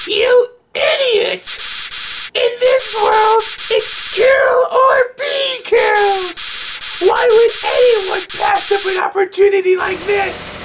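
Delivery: extremely high, slow villain screech; Flowey. Acted. Flower turns on the trapped player, slow villainous screech. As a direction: drag out each word in a shrieking creature voice. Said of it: "use Jack's voice for Flowey".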